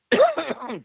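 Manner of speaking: choking cough from wrong pipe; sharp real cough, choking; coughing up cocoa. Real, not acted. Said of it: "went down the wrong pipe".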